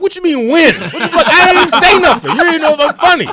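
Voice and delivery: loud, very high-pitched outburst; indignant protest. Retold or imitated. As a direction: protest in hammed-up disbelief, voice shooting up. Protests the usher's prediction.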